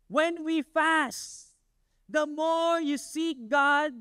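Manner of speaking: slow, weighty preaching line. Acted. Preacher teaching the church about fasting, slow. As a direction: lay each word down slowly for the church.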